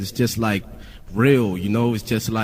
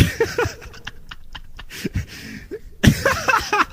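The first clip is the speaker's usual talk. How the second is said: high, breathless cracking up; laughing fit; 4 dB quieter than usual. Real, not acted. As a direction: burst out laughing at your own impression. Cracks up at her own imitation.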